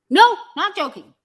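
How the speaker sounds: high, loud burst of indignation; angry retort. Acted. Insisting to God this is no joke, angry retort. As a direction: fire back a loud, offended denial.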